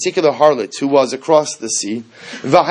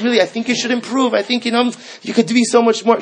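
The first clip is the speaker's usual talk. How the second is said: lightly voiced mentor, pitched higher; mock yeshiva mashgiach; 7 semitones higher than usual. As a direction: gently parody a supervisor giving earnest guidance. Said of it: "she's not like a mashgiach in a yeshiva".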